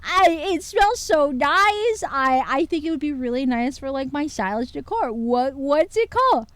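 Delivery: high squealy gushing over a perfume; gushing fangirl. Retold or imitated. Retelling her own excited gushing to chat. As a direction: gush like a fan who just found merch. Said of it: "I was like".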